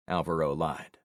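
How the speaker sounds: ordinary delivery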